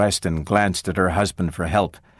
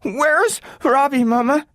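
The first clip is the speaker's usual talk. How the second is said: put-on girl fearing her robot is gone; near tears; 3 dB louder than usual. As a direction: ask close to crying. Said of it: "voice quavered on the verge of tears".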